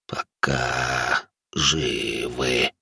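very slow, low, soft creature voice; laboured ghoul speech. A narrator's reading. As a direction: drag each word out as if speech is hard. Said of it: "людская речь говорящему даётся с немалым трудом". (низкий тягучий голос гуля, речь с трудом)

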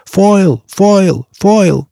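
громкий высокий выкрик имени, раз за разом; выкрикивать имя, разыскивая его; солдатский крик. A narrator's reading. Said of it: "крича". (громкий крик)

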